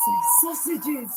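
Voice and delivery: slow, drawn-out "Say sausages"; coaxing sing-song. Shown as a demonstration. Tempting the dog spirit with treats, slow coaxing sing-song. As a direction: stretch "Say sausages" out slowly to tempt her.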